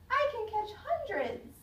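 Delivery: high, excited shout of joy; child shouting for joy among fireflies; joyful shout. Acted. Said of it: "I felt a tremble of joy and shouted".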